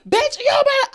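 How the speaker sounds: high, loud imitation of a fighting couple; mock couple's yelling. Imitating couples fighting at the house, high mock yell. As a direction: yell it like a roommate mid-argument. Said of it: "they be yelling".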